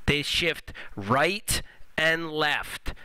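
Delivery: drawn-out, every word separate; very slow and clear. Shown as a demonstration. Teacher drilling the rule for students, very slow and clear. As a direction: lay each word down slowly. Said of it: "Let me say that again really clearly".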